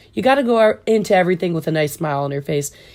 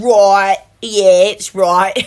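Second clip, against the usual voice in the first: put-on louder, drawn-out Australian accent; mock Australian accent; 6 dB louder, much slower than usual. Quoting a favourite show to friends, mock Australian accent.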